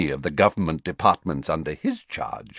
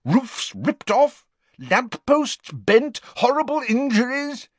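Prime Minister; slower than usual. Acted. Prime Minister listing damage.

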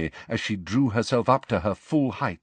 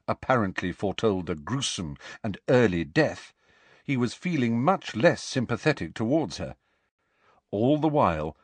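ordinary delivery, nothing marked

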